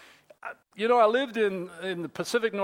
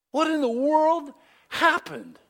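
high-pitched, exasperated bewilderment; baffled dismay; 8 semitones higher, 4 dB louder than usual. Retold or imitated. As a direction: ask it as if stunned by the ruin. Looking at his ruined lawn.

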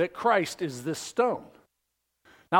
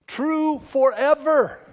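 higher, louder and slow; emphatic proclamation; 8 semitones higher, 6 dB louder, slower than usual. Real, not acted. Preacher proclaiming to the congregation, loud and slow. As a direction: proclaim it with full force.

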